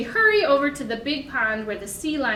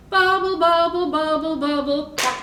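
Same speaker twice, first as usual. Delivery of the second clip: louder, higher bubble, bubble; 4 semitones higher, 5 dB louder than usual. Shown as a demonstration. Librarian making the loud bubble part for kids, higher and louder. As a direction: pop the bubbles out loud and high. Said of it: "making that loud bubble sound".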